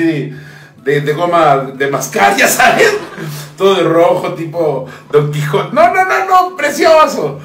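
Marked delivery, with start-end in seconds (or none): none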